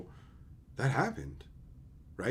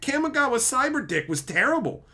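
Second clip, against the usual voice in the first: ordinary delivery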